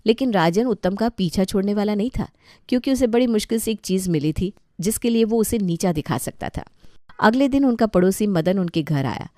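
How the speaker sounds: usual speaking voice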